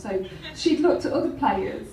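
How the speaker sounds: normal talking voice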